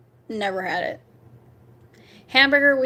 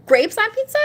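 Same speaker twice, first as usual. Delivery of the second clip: surprised; 11 semitones higher, 5 dB louder than usual. Real, not acted. Reading a viewer's pizza suggestion.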